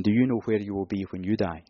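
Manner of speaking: asked softly and gravely; solemn soft question. Shown as a demonstration. Preacher puts the final question to the hall, softly and gravely. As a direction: let the last question sink in quietly. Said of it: "I'm going to finish off by asking the question".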